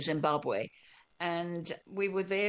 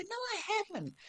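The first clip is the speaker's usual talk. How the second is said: much higher, put-on protesting voice; mock indignant denial; 4 dB quieter, much faster than usual. Telling her Australian friend she has no accent, mock indignant. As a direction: deny it in a squeaky, playful protest. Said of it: "And I go".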